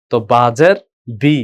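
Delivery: ordinary talk, nothing marked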